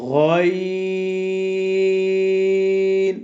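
গইন held very long, gargled from the back of the throat; teacher modelling গইন; drawn-out letter demo. Shown as a demonstration. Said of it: "হলকের মধ্যখান হতে".

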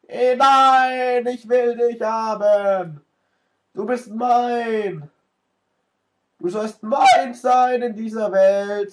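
theatrical greedy lover voice; mock dramatic possessive. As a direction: ham up a desperate claim to the prize. Possessive mock pleading to item.

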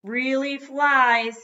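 high, slow, loud sales pitch; toy box slogan. A narrator's reading. Toy box promising its wonders, high drawn-out announcement. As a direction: draw it out like an advertising slogan. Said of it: "said the box".

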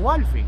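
"Walfin" said loudly, stress on the first syllable, WÁLfin; local street pronunciation. Retold or imitated. Telling the host how locals name the street, a loud "Walfin". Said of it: "le dicen".

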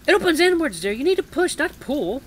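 high, sing-out granny voice; helpful old lady, high voice; sweet granny. A narrator's reading. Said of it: "voice is as soft as sweet".

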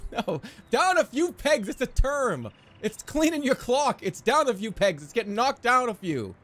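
high, insistent explaining; playfully flustered, high-pitched insistence; indignant protest. Real, not acted. Protests laughing listeners misreading his phrase.

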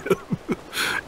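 silent airy laugh; a wheezy little chuckle to himself; breathy chuckle. Acted. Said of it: "chuckled Pugwash".

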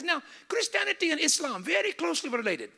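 put-on high Middle Eastern accent. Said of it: "I gotta do the accent".

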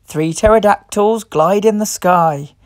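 ordinary delivery, nothing marked

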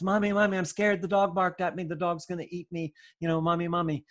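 put-on high, frightened child's voice calling mommy; scared little child. Toddler running to mommy after the dog barks, high and frightened.

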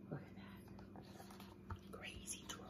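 very soft, slow whisper; whispered aside. Real, not acted. Librarian shows children the troll's picture, whispered aside. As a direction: whisper it confidingly to the listeners.

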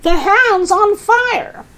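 high, drawn-out alarm; yelling. Acted. Grandma raises the alarm about the hen, yelling. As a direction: cry fire from the rooftop. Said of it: "Grandma yelled".

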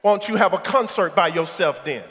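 ordinary delivery

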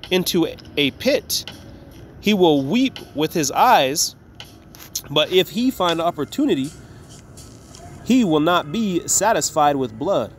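slow, solemn reading of the verse; measured King James recitation; scripture reading. Acted. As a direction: read it out like holy writ, slowly. Reads scripture aloud.

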